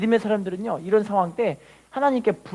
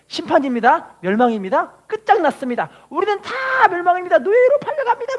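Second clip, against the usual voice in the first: higher and louder, rising doom proclamation; prophet proclaiming doom; 8 semitones higher, 4 dB louder than usual. Retold or imitated. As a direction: cry out judgment like a prophet. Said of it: "막 그렇게 선포하고 있는데".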